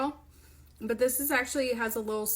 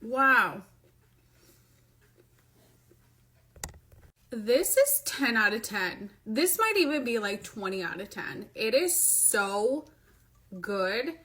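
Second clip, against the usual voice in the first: excited; 7 dB louder than usual. Real, not acted. First sip of favourite drink.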